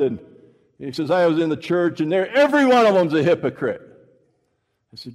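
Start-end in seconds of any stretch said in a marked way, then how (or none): 1.1-3.8 heated complaint. Retold or imitated. Atheist's bitter complaint, louder.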